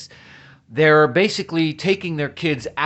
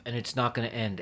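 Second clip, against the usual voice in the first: soft, worn-out retelling of the nurse; tired nurse quote; 5 semitones lower, 13 dB quieter, faster than usual. Retold or imitated. Nurse on TV saying it won't end, a quiet tired echo. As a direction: echo her exhaustion quietly. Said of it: "She said".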